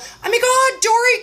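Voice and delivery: high, squealing hello; gushing welcome; excited greeting. Retold or imitated. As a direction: greet her like a long-lost friend. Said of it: "instead of my normal".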